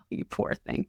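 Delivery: unvoiced whisper of pity. Aside to a trained salesperson, soft whispered pity. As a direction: breathe it out pityingly. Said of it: "And I'm like".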